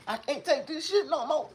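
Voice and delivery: very high tearful wail; crying. Real, not acted. Streamer overwhelmed by his own memory, high crying wail. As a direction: cry it out like you're at breaking point. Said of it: "it make me cry again".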